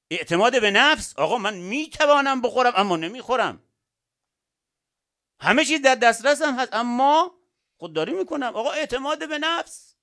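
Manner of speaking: loud, high-pitched proclamation of self-control; confident declaration. Acted. Strong person declaring they can eat but won't, confident declaration. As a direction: declare it forcefully, as a proud resolve.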